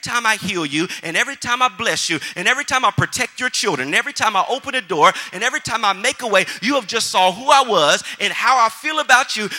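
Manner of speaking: loud, driving sermon voice with rising force; fiery preaching. Acted.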